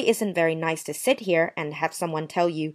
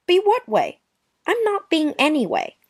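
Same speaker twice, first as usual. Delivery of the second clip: offended; 10 semitones higher than usual. Acted. Offended denial in quarrel.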